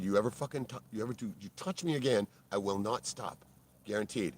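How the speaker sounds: normal talking voice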